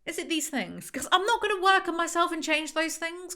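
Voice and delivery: ordinary talk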